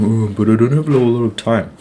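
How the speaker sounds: put-on whiny excuse-maker; mock complaint. Imitating viewers who dodge exercise, mock whining complaint. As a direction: imitate someone moaning an excuse. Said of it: "you guys are going".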